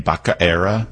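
"Becerra" garbled as BAH-kuh-AIR-uh; Biden's mispronunciation. Retold or imitated. Host recalling Biden's botched name, "Becerra" as bocka-era. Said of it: "Didn't Biden pronounce his name".

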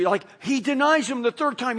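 usual speaking voice

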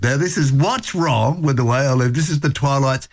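light DJ lift announcing the track; radio song intro. Acted. Host announcing the Twilights song to listeners, DJ style. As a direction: sell the next song to listeners.

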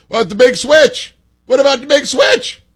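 high, whiny mimicry of a Democrat retort; mocking high voice. Host mocking the critics' comeback in a high whiny voice. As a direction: squeak it out like a smug heckler.

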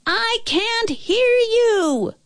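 high, slow, drawn-out holler; shouting back. A narrator's reading. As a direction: call across a great distance. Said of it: "the Nobble shouted back".

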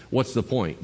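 ordinary talk, nothing marked

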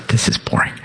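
voiceless, acted whisper of doubt; whispered admission. Writer rereading his own book next day, whispered admission. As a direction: whisper it as a reluctant verdict.

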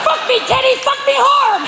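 very high parrot squawk; parrot mimicry. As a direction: screech like a parrot repeating bedroom talk. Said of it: "the parrot will go".

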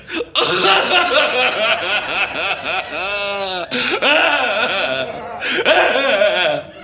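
broken laugh that tips into crying; laughing sob. Real, not acted. Dumped DJ going live, laughing sob. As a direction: laugh through tears, long and helpless. Breaking down on air.

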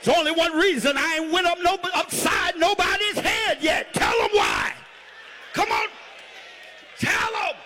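impassioned preacher driving to a climax; full-force preaching. Real, not acted. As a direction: whip up the congregation with hollered bursts.

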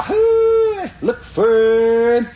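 long high call, then a slow drawn-out finish; drawn-out call to the dogs; hunter's holler. Retold or imitated. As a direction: urge the hounds on from far off. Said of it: "my brother Sonny holler".

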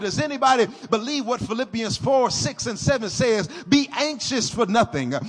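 loud, rising, whooping sermon; preaching at full force. Acted. Pastor rousing the congregation to believe in prayer, loud. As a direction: build the church up to a roar.

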